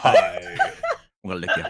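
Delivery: slow, low, laughing menace; giggling low-voiced leer; laughing lick threat.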